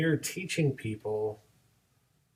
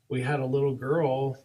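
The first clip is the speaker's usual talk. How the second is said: ordinary talk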